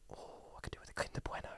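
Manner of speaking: tiny, secretive whisper; sudden idle craving, whispered; inner-thought whisper. Retold or imitated. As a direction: think aloud, barely audible. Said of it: "my mind goes".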